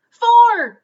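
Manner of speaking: high, very loud "Four!"; know-it-all yelling answer; shouted answer. Acted. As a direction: blurt it out at full volume. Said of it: "shouted Camilla Capybara".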